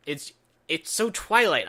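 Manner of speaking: "Twilight" said in a put-on swooning voice, the word "so" left light; mock-dreamy voice on "it's so Twilight"; lampooning the sappy scene in a put-on voice. Shown as a demonstration. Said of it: "I don't have any other way to describe it".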